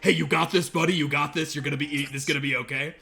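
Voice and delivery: rally the horse like a nervous friend; loud encouragement. Acted. Rider slapping his horse to urge it on, loud. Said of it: "slapping the horse and going".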